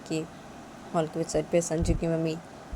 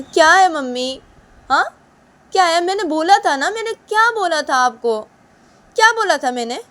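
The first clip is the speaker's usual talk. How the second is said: much higher, louder angry scolding; daughter-in-law furiously scolding mother-in-law; angry Uma; 11 semitones higher, 11 dB louder than usual. Acted. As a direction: shout at her for not listening.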